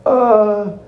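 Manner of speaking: high-pitched, panicky stammer; flustered Joe, stalling. Acted.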